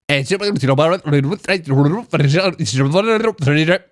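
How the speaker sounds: rapid nonsense babble; mock Braille-reading gibberish. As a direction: rattle off gibberish as if fingering Braille. Imitates reading Lego like Braille.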